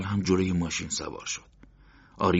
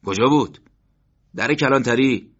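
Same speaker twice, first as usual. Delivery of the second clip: louder, higher exchange; loud overheard voices; 7 semitones higher, 10 dB louder than usual. A narrator's reading. Men talking loudly nearby, raised voices. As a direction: call across the room.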